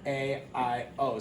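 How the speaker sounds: ordinary talk, nothing marked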